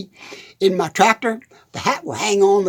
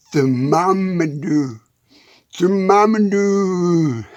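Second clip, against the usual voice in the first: Thermometer drawn out syllable by syllable; slowed down; 4 semitones lower, much slower than usual. Shown as a demonstration. Farmer tries the hard word again, saying it very slowly. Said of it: "Do it slow, [name]".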